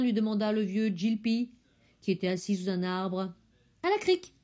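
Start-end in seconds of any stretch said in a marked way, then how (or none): 3.8-4.3 petit mensonge d'enfant, très aigu et léger; répond vite pour cacher la vérité. A narrator's reading. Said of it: "mentit Tingiri". (la fillette ment au vieux Jilpi, voix aiguë et légère)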